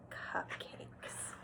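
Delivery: soft hushed whisper. Shown as a demonstration. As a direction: lean in and whisper the treat. Teacher recap, whispering key word.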